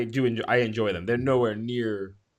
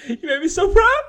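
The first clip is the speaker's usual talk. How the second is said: high squeaky laughing praise; laughing mock pride; 4 dB louder than usual. Real, not acted. Cohost teasing the host, laughing mock pride. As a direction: laugh through the words, teasing.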